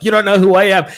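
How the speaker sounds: loud, high teasing retort; mock taunt. Mimicking LinkedIn users with privacy on, loud mock taunt. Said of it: "They're like".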